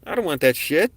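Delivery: shouting it off in alarm; alarmed outburst. Retold or imitated. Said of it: "I was like".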